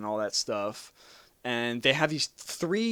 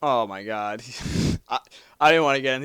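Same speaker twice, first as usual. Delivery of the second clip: ordinary talk, nothing marked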